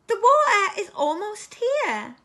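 high, loud squeaky call; squeaky giraffe. A narrator's reading. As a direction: squeak urgently as a storybook giraffe. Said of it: "he squeaked in his high voice".

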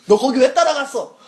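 sharp, loud scold from a superior officer; scolding commander. Retold or imitated. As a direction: bark the question like an angry captain.

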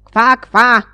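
loud croaking kwa, kwa; frog croak. Acted. The frog croaking at the floating hat, loud. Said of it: "zarechotała".

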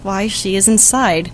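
ordinary talk

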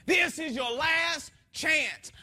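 a commanding voice that isn't playing; authoritative command. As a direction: bellow it slowly as God's final warning. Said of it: "you knew it was Daddy God and he wasn't playing".

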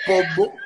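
"pombo" for polvo, L dropped, b doubled; Cuban accent polvo. Shown as a demonstration. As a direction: say "pombo" Cuban-style, clearly and loud. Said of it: "Tú quitas la R, la L". ("pombo" en cubano, sin la L y con b doble)

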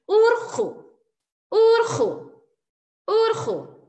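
URJU high and loud, split UR-JU; held high UR, then falling JU; syllabifying. Shown as a demonstration. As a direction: break URJU into two clear syllables for learners. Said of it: "hagamos nosotros el silabeo".

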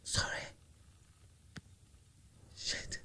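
barely voiced curse after a fright; breathless hushed swear; shaken whisper. Real, not acted. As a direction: whisper it, still rattled.

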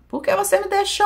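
retold scream. Woman at the morgue screaming at her husband, high retold scream. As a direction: imitate a woman's high-pitched wail. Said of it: "começou a bater no marido e gritar".

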